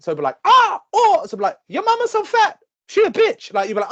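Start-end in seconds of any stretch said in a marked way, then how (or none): 0.4-1.2 high, loud mock caw; piercingly pitched bird cry; crow squawk. Imitating crows heckling. 1.7-3.5 high squawked taunt; loud, high-pitched mock insult; heckling crow. Acting crows shouting insults.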